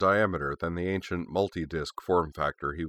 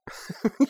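breathy giggle. Acted. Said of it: "unable to suppress a giggle".

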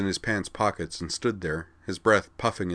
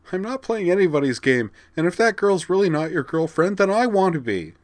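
higher and louder, urgent; words spilling out; 12 semitones higher, 6 dB louder than usual. Acted. Vigdis, confessing her feelings to Corwin, urgent. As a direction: pour it all out at once. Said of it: "The words spilled out of her now".

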